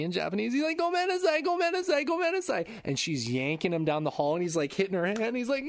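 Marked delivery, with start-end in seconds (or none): 0.8-2.7 high-pitched repeated pleading, imitating a pupil; frantic apology. Boy dragged down the hall apologizes, frantic high squeal. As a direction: plead in a squeaky rush of sorries. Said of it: "trying to apologize".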